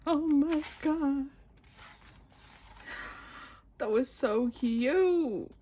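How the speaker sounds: high, loud, drawn-out whine; gushing squeal. Real, not acted. Fan finishing the photo book, gushing squeal. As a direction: wail it slowly in delight.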